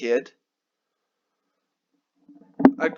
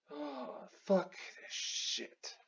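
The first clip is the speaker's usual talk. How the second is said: unvoiced, slow and much softer; whispered give-up; 3 semitones higher, 20 dB quieter, much slower than usual. Real, not acted. Vlogger interrupted, whispered exasperation. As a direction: breathe it out in defeat.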